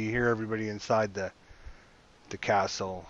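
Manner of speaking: normal talking voice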